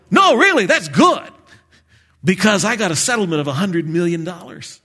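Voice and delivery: high, loud hillbilly barber cheer; cheery old barber. Retold or imitated. Preacher plays the Hee Haw barber, high and loud. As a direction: crow it like a grinning country barber. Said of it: "And he would go".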